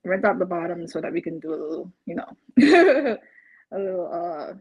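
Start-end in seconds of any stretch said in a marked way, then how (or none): 2.5-3.2 bright burst of laughter. Real, not acted. Homebuyer laughing while talking about rental income. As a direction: laugh it off lightly.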